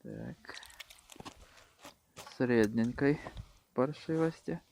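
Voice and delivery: usual speaking voice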